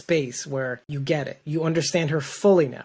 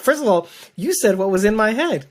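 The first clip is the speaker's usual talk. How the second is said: laughing delight; 6 semitones higher, 5 dB louder than usual. Retold or imitated. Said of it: "I'm like".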